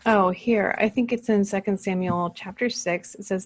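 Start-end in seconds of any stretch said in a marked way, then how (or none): none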